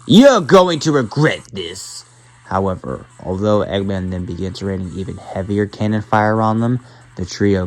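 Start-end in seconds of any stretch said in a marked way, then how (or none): 0.1-2.1 loud, high, drawn-out threat; furious villain's warning, raised and loud; angry yell. A narrator's reading. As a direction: yell it slowly in anger, pitched up. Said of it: "Eggman to get angry with him yelling".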